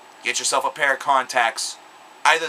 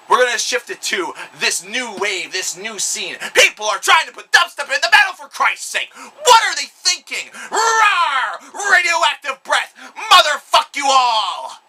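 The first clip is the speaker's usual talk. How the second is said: much higher and louder, a raging monster shout with a roar; Godzilla roar; 11 semitones higher, 5 dB louder than usual. Retold or imitated. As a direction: bellow like a monster rising from the ocean. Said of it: "Godzilla would ... would say".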